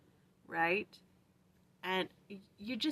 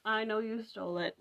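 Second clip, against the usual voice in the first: normal talking voice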